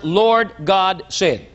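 "Lord God said" slow, every word stressed; underlined emphasis. Shown as a demonstration. Preacher repeating the words to underline, "Lord God said" very slow. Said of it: "Underline the words".

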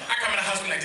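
normal talking voice

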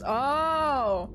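ordinary delivery

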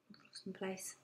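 real aside from the reader who lost her place; whispered aside. Real, not acted. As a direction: breathe it as a quick private aside.

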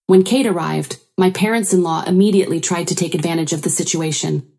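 ordinary delivery, nothing marked

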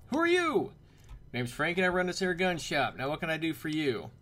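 brash storekeeper sizing up a customer; Frankie. Acted. Shopkeeper greeting a stranger in his gun shop, loud put-on voice.

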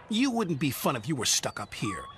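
plain reading voice

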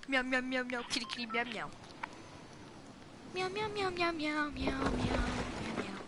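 soft mock kitten meowing; cat meows. Gamer teasing teammate, soft mock meows. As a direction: purr out little kitty meows.

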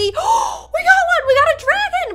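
high, squealing delight at the dragon; real burst of joy at a legendary; excited gasp. Real, not acted. Gasps on hatching a legendary.

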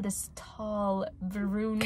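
drawn-out, swoony "tall brunette"; very slow, dreamy storytelling; dreamy dramatic reading. Acted. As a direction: linger on TALL and BRUNETTE for the vibes. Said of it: "read it normally?".

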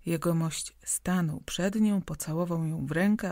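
ordinary delivery

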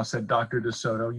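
ordinary delivery, nothing marked

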